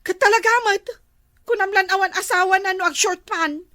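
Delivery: loud indignant tirade; irate and forceful; jealous outburst. Acted. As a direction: sound off loudly about the rival. Wife rails about rival's short pants.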